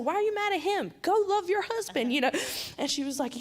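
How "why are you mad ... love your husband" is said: much higher, insistent mock rebuke; retold scolding plea. Urging Brittany toward her husband, much higher insistent rebuke. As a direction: push her to go to him, half scolding. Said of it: "And I was like".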